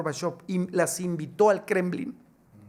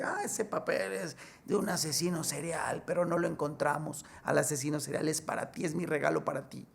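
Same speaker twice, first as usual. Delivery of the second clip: soviet director; 7 dB quieter than usual. Acted. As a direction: hand over the gift in a hushed voice. Voicing the prison director. (director soviético en voz baja)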